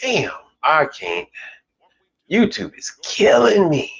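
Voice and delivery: ordinary delivery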